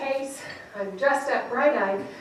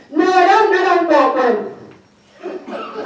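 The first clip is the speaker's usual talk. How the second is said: loud, drawn-out mock refusal; hammed-up angry outburst; angry buyer imitation; 5 semitones higher, 8 dB louder, much slower than usual. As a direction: bellow it like a grumpy buyer, stretching the end. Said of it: "he looked angry".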